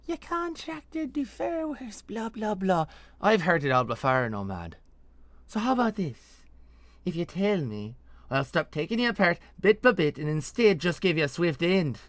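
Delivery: put-on high, loud, impatient accented voice; hard-nosed interrogator scoffing, then laying down terms; Nesrathi interrogator, mocking. Interrogator offering her captive a swift end, impatient menace. Veliana, masked interrogator, mocks and bargains.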